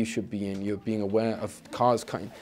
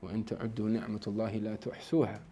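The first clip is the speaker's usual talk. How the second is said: Quran recitation; 5 dB quieter, much faster than usual. Real, not acted.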